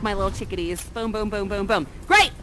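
usual speaking voice

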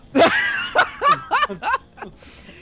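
loud, high burst of laughter; real laughing fit. Real, not acted. Reacting to the interruption, loud laughing. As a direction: crack up loudly.

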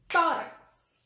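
Tórax with TÓ loud and high; stress on first syllable. Shown as a demonstration. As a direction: punch the TÓ of Tórax, then fall. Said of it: "aí nós temos o tó".

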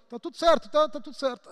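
high, strained insistence that everything's fine; mock strained everything's-fine voice; strained denial. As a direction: force out "fine" while hurting. Voicing person denying the burden.

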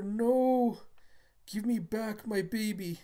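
high, loud acted cry; desperate plea. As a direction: cry out for a stolen baby. Mother pleads for her baby.

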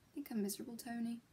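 whispering. Acted. Confession in a hushed aside.

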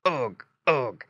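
light piggy grunting; pig grunts. A narrator's reading. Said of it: "grunted Squinty".

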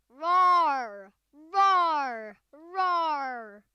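loud playful monster roars; werewolf roar. Pretend. Kid showing off her werewolf pet, loud mock roar. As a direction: roar like a pretend werewolf at the viewers. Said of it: "I'm a werewolf, man. Look at me".

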